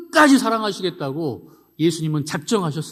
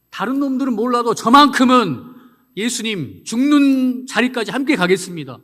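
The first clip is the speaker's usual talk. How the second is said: high, loud confident vow; bold boast; 7 semitones higher, 7 dB louder than usual. Retold or imitated. Peter boldly vowing to Jesus to follow him. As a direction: swear it with full bravado.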